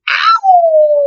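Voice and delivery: very high, loud yelp; yelp of pain. Acted. Wolf's nose slammed in the door, high loud yelp. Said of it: "said the wolf".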